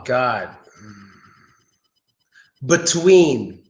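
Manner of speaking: ordinary talk, nothing marked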